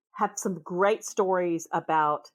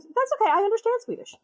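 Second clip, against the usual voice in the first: amused stranger; 3 dB louder, faster than usual. Retold or imitated. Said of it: "he goes".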